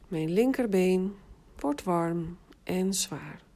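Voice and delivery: slow, even autogenic formula. Shown as a demonstration. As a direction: say it slowly, for them to repeat. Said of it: "herhaal twee keer de zin". (langzame formule)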